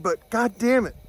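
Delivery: normal talking voice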